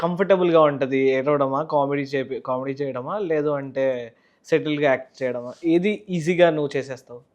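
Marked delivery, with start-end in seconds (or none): none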